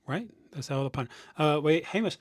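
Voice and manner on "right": high, quiet doubtful "eh"; surprised eh. Retold or imitated. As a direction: imitate a skeptical Japanese recruiter. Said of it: "they'll go".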